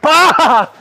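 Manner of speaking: a loud laughing yelp, high; sudden high laughing shout. Real, not acted. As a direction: burst out with a laughing yell.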